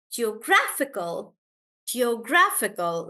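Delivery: geographical with GRA punched; stress on GRA. Shown as a demonstration. Teacher showing why we say geographical, gra stressed. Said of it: "the stress is on gra".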